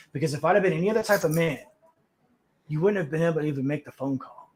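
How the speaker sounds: 压低嗓音，平静而带威慑地警告; retold calm warning. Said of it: "I'm just real calm".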